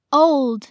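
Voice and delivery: put-on "old" with a dark, blurred L before the d. Shown as a demonstration. Teacher demonstrating the blurred L to learners, dark L in "old". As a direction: say "old" clearly, darkening the L. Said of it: "L音要读成模糊舌边音".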